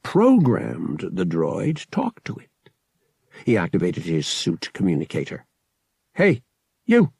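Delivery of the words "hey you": short, sharp call for attention. A narrator's reading. Pilot hails droid over suit communicator, calling out.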